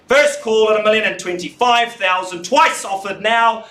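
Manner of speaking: high, loud, slow calling; loud auctioneer call. Real, not acted. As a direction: call it out to the room.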